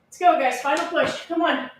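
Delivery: upbeat gym coach calling out; coach cheering. Acted. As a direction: pump up the class, counting down. Coach cheering through a workout.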